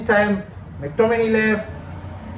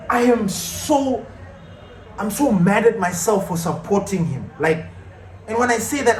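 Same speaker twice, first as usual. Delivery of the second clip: ordinary talk, nothing marked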